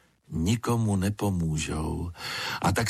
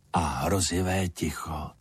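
plain reading voice

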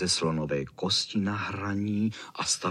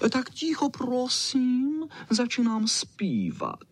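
very high, prissy announcement; Čau Čau, prima donna dog; slower than usual. Acted. Čau Čau hushes everyone before singing, high and prissy. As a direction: pipe it high and slow.

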